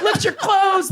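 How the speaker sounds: very high mocking jeer; mocked heckler. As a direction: parody the heckler's taunt. Imitates the heckler's insult.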